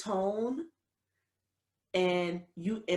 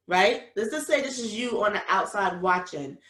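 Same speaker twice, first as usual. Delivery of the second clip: ordinary talk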